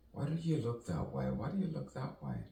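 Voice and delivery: high-pitched imitation of someone asking; mock questioner. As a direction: put on a high voice to question him. Acts out someone's puzzled question.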